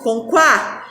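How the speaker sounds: "com quá" with the u heard, kwa; syllable quá. Shown as a demonstration. Teacher modelling "quá" for children, u voiced. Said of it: "com que?".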